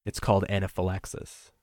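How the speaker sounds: usual speaking voice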